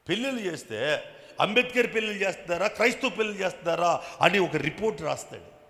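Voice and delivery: loud, high, indignant preaching; fiery preaching. Acted. Pastor denouncing rivals to his flock, loud indignant preaching. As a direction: rail against the jealous neighbour from the pulpit.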